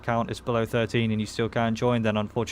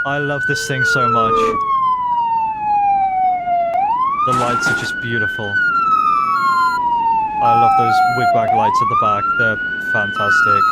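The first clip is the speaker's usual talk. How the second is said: excited; 8 dB louder than usual. Real, not acted. Hyped joyride.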